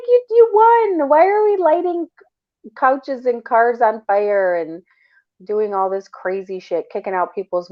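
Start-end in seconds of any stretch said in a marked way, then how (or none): 0.1-4.6 much higher, slow disbelief; can't believe they riot after winning; incredulous outburst. Real, not acted. Incredulous at fans rioting.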